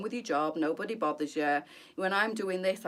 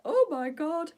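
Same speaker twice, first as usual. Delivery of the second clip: exasperated; 11 semitones higher, 5 dB louder, slower than usual. Retold or imitated. Exasperated reaction to criticism.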